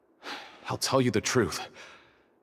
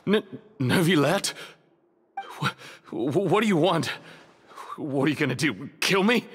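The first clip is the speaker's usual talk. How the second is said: frightened stammer; 5 semitones higher than usual. Acted. Cornered prisoner faces Neuvillette.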